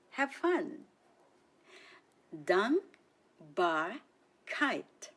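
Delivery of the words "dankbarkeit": Dankbarkeit stretched very slow, syllable by syllable; slow model word. Shown as a demonstration. Teacher to learners, slow model word. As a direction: spell out Dankbarkeit slowly. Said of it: "Listen and repeat after me".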